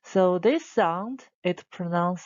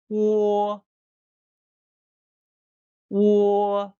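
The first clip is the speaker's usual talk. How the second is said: "wo" long, loud and level; first tone; 6 dB louder than usual. Shown as a demonstration. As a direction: hold "wo" steady on one pitch. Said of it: "so the first tone".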